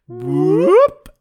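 loud rising whoop; sudden, loud comic whoop; cartoon whoop. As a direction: whoop like a slide whistle going up. Sound imitated for sneaky insert.

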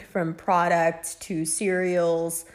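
ordinary talk, nothing marked

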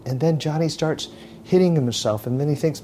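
ordinary talk

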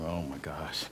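whispered aside to himself. Retold or imitated. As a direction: breathe it out under his breath.

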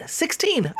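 sixteen shot up high in disbelief; outraged sixteen. Real, not acted. Furious host stressing the minutes he waited: sixteen, high.

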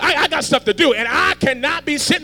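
high, loud shouted preaching; full-force preaching. Real, not acted. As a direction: hammer it home to the crowd.

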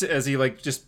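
normal talking voice

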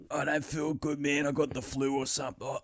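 quiet, coughing sick-call act; playing sick. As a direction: put on a feeble flu excuse with coughs. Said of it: "And you'll be like".

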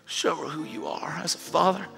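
soft, breath-heavy private prayer; pleading prayer. Acted. Husband praying alone for his wife, hushed heartfelt plea. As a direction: plead quietly with God, as if on your knees. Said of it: "gets on its knees and says".

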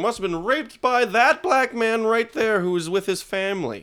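high, put-on accusing voice; mock accuser. Mocking a father blaming an innocent man, in a high accusing voice. Mimicking a historical false accuser.

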